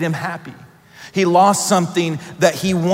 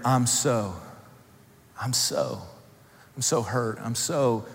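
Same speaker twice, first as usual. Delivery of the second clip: much softer, trailing inward; quiet confession; 5 semitones lower, 8 dB quieter than usual. Acted. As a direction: murmur it as a private admission.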